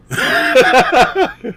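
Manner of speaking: a burst of high, loud real laughter. Real, not acted. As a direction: crack up at the clip. Laughing at Floyd clip.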